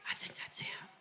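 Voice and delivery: hushed, excited whisper to his wife; stage whisper. Retold or imitated. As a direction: whisper it like you just spotted a celebrity. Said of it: "I hear this guy go".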